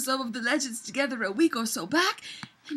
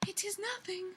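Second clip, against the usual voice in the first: soft unvoiced reassurance; whispered reassurance; 7 semitones higher, 14 dB quieter, faster than usual. Elf soothing Loki, whispered. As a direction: whisper it gently to calm him.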